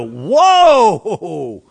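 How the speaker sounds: long, loud swooping whoa; awestruck whoa. Acted. God predicts the prophet's amazed reaction, a booming drawn-out whoa. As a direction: let out a big amazed whoa. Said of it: "gonna have you go".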